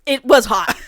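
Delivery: ordinary talk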